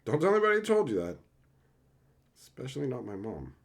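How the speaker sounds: very fast hushed confiding aside; rushed secret aside. Pretend. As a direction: blurt it out quickly like a secret.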